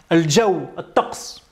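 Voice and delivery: ordinary talk, nothing marked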